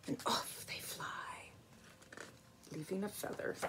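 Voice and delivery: hushed storytelling whisper. A narrator's reading. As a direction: let it drift off in a whisper. Storytelling hush.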